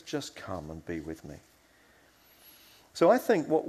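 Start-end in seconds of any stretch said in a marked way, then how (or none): 0.0-1.4 low, very soft and tender; hushed, kindly welcome; gracious invitation. Acted. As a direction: invite a friend gently to your side. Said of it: "invites Peter and says".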